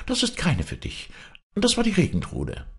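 put-on high girl's voice putting him straight; admonishing girl. A narrator's reading. Girl to young man about the Regentrude, admonishing high. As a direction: tell him off, pitched high. Maren warns Andres off.

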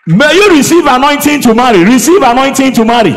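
very loud, booming declaration; preached blessing. Real, not acted. Preacher blesses the unmarried congregation, booming declaration. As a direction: proclaim it over the crowd at full force.

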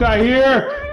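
high, loud panicked yell. Real, not acted. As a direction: scream it out in panic. Panicked in horror game.

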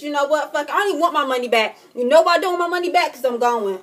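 loud, high, fast outburst; heated and defiant; angry resolve. Real, not acted. Angry decision to confront him.

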